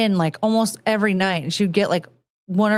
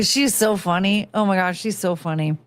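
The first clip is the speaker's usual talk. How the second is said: ordinary talk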